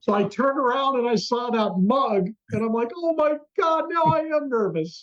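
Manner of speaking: a star-struck yelp, voice jumping up high; excited panic. Retold or imitated. Star-struck guest realizing [name] is behind him, high panicked yelp.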